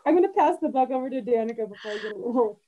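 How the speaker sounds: laughing through tears of emotion; holding back tears. Real, not acted. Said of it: "I'm trying not to cry".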